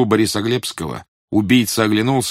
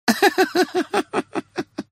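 high tittering giggle; small giggle. A narrator's reading. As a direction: titter in short bursts. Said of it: "мелко рассмеялся".

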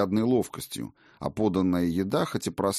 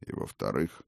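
put-on teasing old king; 10 semitones lower, 7 dB quieter than usual. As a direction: tease her with a lingering rise. Odin repeats teasingly.

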